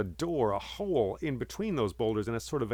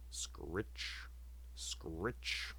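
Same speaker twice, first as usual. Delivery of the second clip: hushed, rasping scrape of an axe on stone; whispered axe-sharpening sound; whispered scritch; 6 semitones lower, 15 dB quieter than usual. Acted. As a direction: breathe it like a blade being sharpened. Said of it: "the sound of an axe being sharpened".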